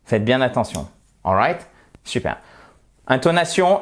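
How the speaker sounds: usual speaking voice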